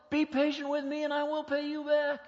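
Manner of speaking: high, loud, begging voice; desperate pleading. Acted. Servant about to be sold begs his master, frantic pleading. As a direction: plead for your life and family. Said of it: "shaking in fear".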